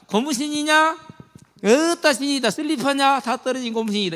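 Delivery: Goliath jeering at the troops; mocking jeer. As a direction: taunt them like a bully. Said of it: "이렇게 놀리고 있다".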